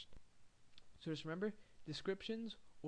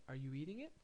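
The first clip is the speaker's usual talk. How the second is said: ordinary delivery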